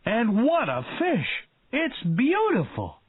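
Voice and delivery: high, thrilled praise; delighted cry. A narrator's reading. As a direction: glow with joy at the shark. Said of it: "He was very happy".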